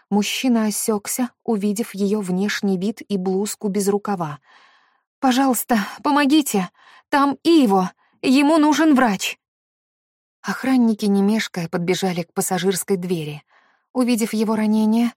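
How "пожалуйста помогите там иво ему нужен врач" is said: high, loud panicked cry for help; desperate plea. Acted. Desperate woman begging for help.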